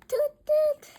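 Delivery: very high, loud toots; piercing high tooting; trumpet toot. A narrator's reading. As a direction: imitate a toy-like trumpet blast. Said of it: "Bean plays his trumpet".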